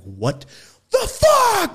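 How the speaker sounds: the fuck jumps sky-high and loud; stunned shock. Retold or imitated. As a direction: yelp it in total disbelief. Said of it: "I'm like".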